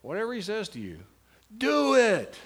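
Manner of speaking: "do" belted high and loud after a short pause; stress on do. Shown as a demonstration. Teacher showing a class word-by-word emphasis, stressing "do". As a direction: lead up, then punch "do" as a forceful command. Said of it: "Just emphasize different words".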